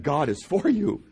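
ordinary talk

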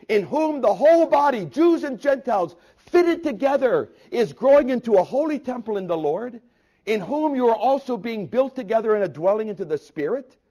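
high, loud, ringing scripture proclamation; full-force preaching. Acted. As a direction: build to a soaring, declaimed peak.

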